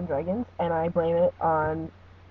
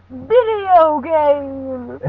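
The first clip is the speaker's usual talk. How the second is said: high, loud, drawn-out exclamation; mock impression; 7 semitones higher, 8 dB louder, much slower than usual. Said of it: "that's my best impression".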